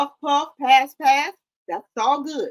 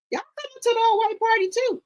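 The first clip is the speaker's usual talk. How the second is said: high, sing-song tease; playful falsetto; 10 semitones higher than usual. Pretend. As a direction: coax them up in a squeaky high voice. Teasing viewers about the party.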